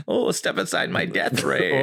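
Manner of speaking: slow, drawn-out mad scientist accent; cartoon crazy German scientist. Shown as a demonstration. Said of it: "I like to read it in, like, cartoon, crazy German scientist voice".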